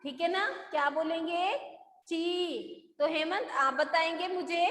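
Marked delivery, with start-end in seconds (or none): 2.1-3.0 "ची" with the ee held long; long ee vowel. Shown as a demonstration. Teacher repeating the example for students, long-drawn "ची". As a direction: stretch out the vowel of "ची". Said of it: "लंबा हो जाता है".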